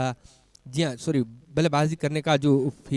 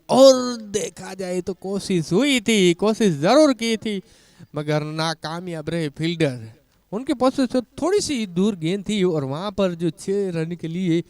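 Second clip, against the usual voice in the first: louder, higher call as the six lands; excited cricket commentary; 5 semitones higher, 6 dB louder than usual. Real, not acted. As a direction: build excitement as the fielder fails. Fielder chasing a six.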